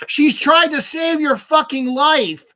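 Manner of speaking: high, loud, slow furious shout. Real, not acted. Ranting at ungrateful fans, furious high shout. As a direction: yell it at them in outrage.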